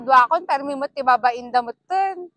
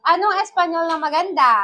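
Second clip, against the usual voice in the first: ordinary talk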